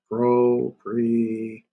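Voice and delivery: PROPRETONIC drawn out slowly, part by part; syllable by syllable. Shown as a demonstration. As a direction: stretch propretonic into pro, pre, tonic with gaps. Teacher breaks term into its parts.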